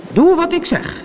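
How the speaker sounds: high-pitched commanding call; imitated captain's call. A narrator's reading. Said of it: "riep Peter Pan".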